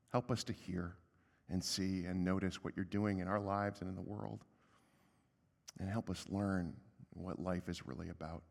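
soft, low, measured prayer; hushed, reverent pastoral prayer. Real, not acted. Leads a prayer.

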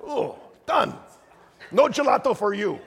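high-pitched hammed-up Italian dismissal; mock Italian accent. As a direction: put on a light comic Italian accent. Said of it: "how they would say it in Italian".

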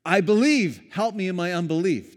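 pleading father. Retold or imitated. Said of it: "the dad says".

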